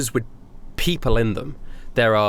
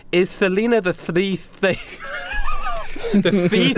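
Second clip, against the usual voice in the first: higher, laughing through the line; breaking into laughter; 8 semitones higher, slower than usual. Acted. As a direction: lose it mid-question.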